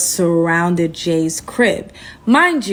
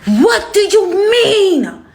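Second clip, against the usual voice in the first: high, drawn-out outraged yell; mock phone scream, stretched words; retold screaming; 10 semitones higher, 3 dB louder, much slower than usual. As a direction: act out a man screaming down the phone. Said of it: "starts screaming on the phone".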